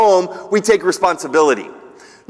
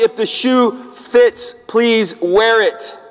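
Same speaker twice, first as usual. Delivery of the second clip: very slow, pointed; slow pointed aside; much slower than usual. Real, not acted. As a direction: let it land slowly. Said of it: "pointed slow aside".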